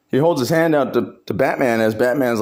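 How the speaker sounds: ordinary delivery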